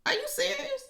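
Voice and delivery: high, squeaky mock surprise; mock shocked disbelief. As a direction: play up the jaw-drop. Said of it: "you be like".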